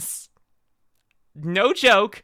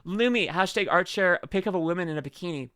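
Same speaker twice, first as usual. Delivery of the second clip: usual speaking voice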